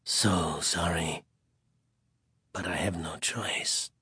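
slow, low, regretful whisper. A narrator's reading. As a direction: sink into a hushed, sorrowful whisper. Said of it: "his voice a whisper of regret".

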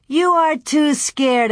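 high, slow, emphatic taunt; taunting boys. A narrator's reading. Almanzo and Pierre tease Louie, emphatic taunt. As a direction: press the accusation like a teasing child.

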